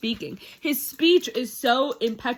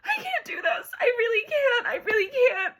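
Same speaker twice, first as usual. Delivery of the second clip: high-pitched, overwhelmed fangirl wail; 11 semitones higher, 5 dB louder than usual. Real, not acted. Reader swooning over her book, overwhelmed wail. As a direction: wail it high, as if you can't cope.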